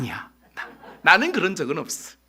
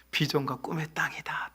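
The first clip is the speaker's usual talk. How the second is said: whispered, much softer, like a secret; whispered promise; 6 semitones lower, 12 dB quieter than usual. Acted. God's promise ends in whisper. (속삭이는 하나님의 약속)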